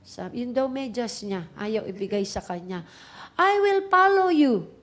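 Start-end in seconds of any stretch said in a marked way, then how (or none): 3.4-4.7 loud, high-pitched mock yell; imitation of a kid yelling at the top of his voice; imitated child's shouting. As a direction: mimic a furious little kid hollering. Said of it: "sigaw siya ng sigaw at the top of his voice".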